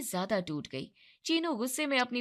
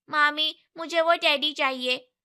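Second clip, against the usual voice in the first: put-on child voice; 5 semitones higher, 6 dB louder than usual. A narrator's reading. Child asking for teddy.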